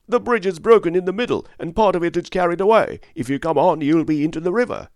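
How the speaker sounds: high, loud and fast; urgent shouted warning. A narrator's reading. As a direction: yell the danger across the water. Toll keeper shouts a warning.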